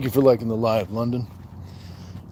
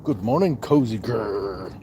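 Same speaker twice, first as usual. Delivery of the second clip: ordinary delivery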